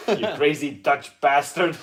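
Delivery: loud, slow film insult through laughter; hammed-up catchphrase, half laughing; Austin Powers quote. Quoting favourite film to co-host, laughing mock insult. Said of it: "we keep making Austin Powers references".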